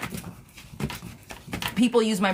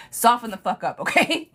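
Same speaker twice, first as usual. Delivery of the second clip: laughing tease; 5 semitones higher than usual. Real, not acted. Teasing a feisty Scorpio client.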